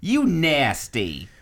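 very slow, drawn-out put-on street voice; a jokey sendup of a street accent. Shown as a demonstration.

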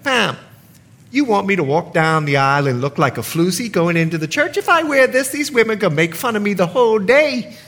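put-on grandmother in a Bahamian accent. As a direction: speak it with a Bahamian lilt. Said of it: "let me put my Bahamian accent on for just a minute".

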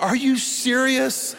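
high, drawn-out mock disbelief; incredulous. Woken napper to nosy seatmate, incredulous. Retold disbelief at question after elbowing.

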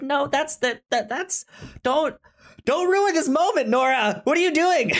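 shrill mock pleading not to ruin it; mock exasperated Kevin. Host parodying Kevin scolding Nora in a high whiny voice. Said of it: "It's like".

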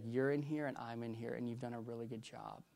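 fading from soft to barely there; tender, near-silent reassurance; hushed God's words. Retold or imitated. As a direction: let God's voice drop to a hush.

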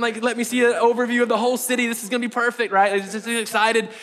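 gushing new-believer excitement, words tumbling out; excited. Acted. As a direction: ramble on, giddy with desire. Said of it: "place of desire".

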